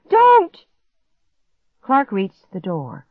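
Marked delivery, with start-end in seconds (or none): 0.1-0.7 very high, very loud single cry; panicked shriek. A narrator's reading. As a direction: scream to stop someone at the last moment. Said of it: "I shrieked".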